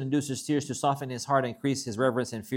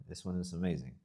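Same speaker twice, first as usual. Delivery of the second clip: usual speaking voice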